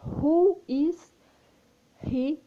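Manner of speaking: stressed question word; stressed Who. Shown as a demonstration. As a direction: punch "Who is", then let "he" drop. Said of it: "aí você vai perguntar".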